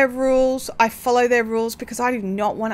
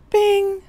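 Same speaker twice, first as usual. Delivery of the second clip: bing sound effect; 9 semitones higher than usual. Pretend.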